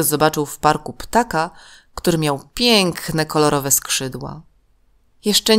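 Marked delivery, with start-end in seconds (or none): none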